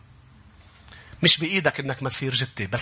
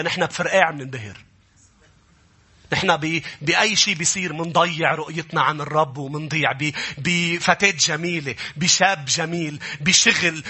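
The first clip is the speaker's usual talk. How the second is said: ordinary talk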